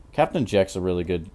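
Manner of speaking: normal talking voice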